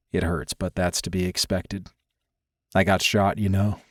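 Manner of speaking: low, soft injured man; Dante, stoic patient. Acted. Injured man downplaying pain to his lover, low soft voice. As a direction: play the hurt down, low and quiet.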